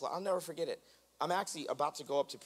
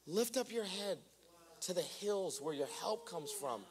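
soft exhortation; 5 semitones higher, 4 dB quieter than usual. Real, not acted. Preaching softly, exhortation.

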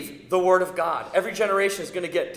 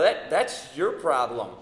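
blunt dad retort; 5 semitones lower, slower than usual. Retold or imitated. Dad's blunt reply to kid.

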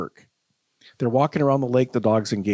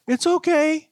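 squeaky high, soothing owner voice; higher octave; slower than usual. Retold or imitated. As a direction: jump way up to reassure the dog. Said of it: "takes those higher octaves".

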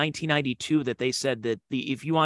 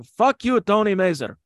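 put-on high, loud Lebanese-accented yell of the name; imitated yell; 6 semitones higher, 6 dB louder than usual. Club owner yelling at comic onstage, imitated loud yell. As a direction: bark it like the owner heckling you. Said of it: "I'm gonna miss him yelling".

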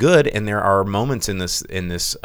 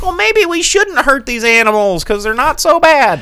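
put-on little girl voice; 7 dB louder than usual. Girl voiced in movie recap.

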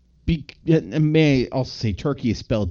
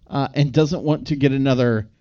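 normal talking voice

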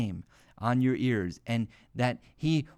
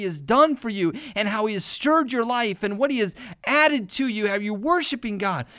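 higher, louder fiery sermon; impassioned preaching; 6 semitones higher, 4 dB louder than usual. Acted. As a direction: press the congregation with each question. Preaching at full force.

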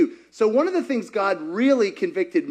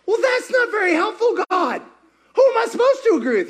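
exasperated complaint; 10 semitones higher, faster than usual. Retold or imitated. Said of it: "I'm like".

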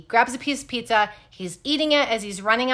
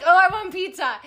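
craving; 8 semitones higher, 4 dB louder than usual. Real, not acted. Craving pizza now, laughing.

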